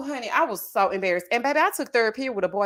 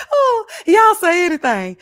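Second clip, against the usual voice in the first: amused exclamation; 8 semitones higher, 9 dB louder than usual. Real, not acted. Recovering from laughing fit.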